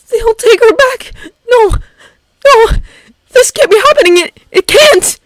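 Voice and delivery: high, frantic denial; panic attack. Acted. Midoriya in a panic attack, frantically denying it. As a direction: cry it out, losing control.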